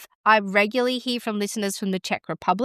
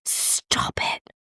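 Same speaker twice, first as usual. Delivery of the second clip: hushed, voiceless gasp of amazement; softly breathed, astonished whisper; stunned disbelief; 5 dB quieter than usual. Real, not acted. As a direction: whisper it as if you can't believe it. Stunned by the ranking news.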